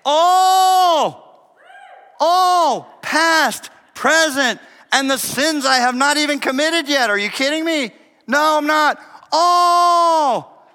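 high, loud exultant shout; astonished, ringing proclamation; amazed. Shown as a demonstration. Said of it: "Say it like it's amazing".

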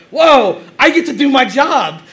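loud, high mock amazement; dumbfounded waiter. Waiter amazed he gets to do his job, mock dumbfounded. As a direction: imitate a waiter thrilled and stunned at his luck. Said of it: "like, dumbfounded".

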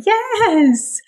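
very high, drawn-out praise; upbeat encouragement. Acted. Trainer as puppy checks out a toy, upbeat encouragement. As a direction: sing out approval to a puppy.

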